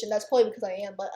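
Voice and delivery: ordinary talk, nothing marked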